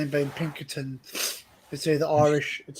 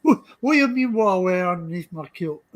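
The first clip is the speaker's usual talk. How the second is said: mock Scottish accent. Scottish character, kilt joke.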